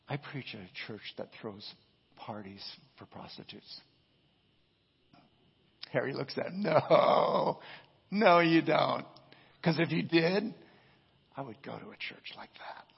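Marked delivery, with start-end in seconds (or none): 0.1-3.8 soft, low retold answer; hushed, understated confession of calling; quiet declaration. Retelling Tony's quiet, weighty answer. 6.6-10.5 loud, raised-voice retold objection; acted emphatic challenge; gruff disbelief. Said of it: "he's gruff and everything". 11.3-12.8 hushed, wistful retold admission; quiet admission. Harry's objection turns to longing.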